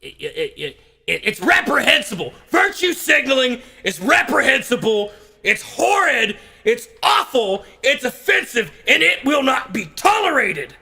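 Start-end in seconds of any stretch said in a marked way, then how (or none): 1.2-10.8 high, indignant denunciation; self-righteous comic condemnation; mock outrage. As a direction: declaim each word in hammed-up indignation. Mock outrage at virtue signaling.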